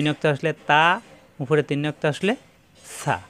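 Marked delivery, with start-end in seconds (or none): none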